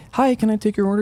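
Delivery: bright high customer-service greeting; chirpy barista voice. Retold or imitated. Cashier greeting a customer at dawn, voiced high.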